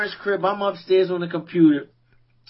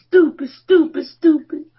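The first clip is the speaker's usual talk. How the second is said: self-scolding; 8 semitones higher, 4 dB louder than usual. Retold or imitated. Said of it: "just saying stupid".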